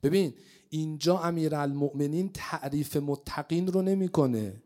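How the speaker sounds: ordinary delivery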